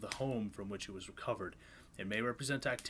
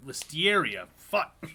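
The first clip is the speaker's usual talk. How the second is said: exasperated outburst; 7 semitones higher, 13 dB louder, slower than usual. Real, not acted. Stumbling over an insane name.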